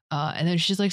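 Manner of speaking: ordinary delivery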